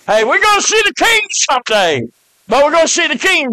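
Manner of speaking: high, loud joyful cry; preaching exclamation. Real, not acted. Preacher crying out to the congregation about seeing the king, joyful shout. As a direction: lift the church with it.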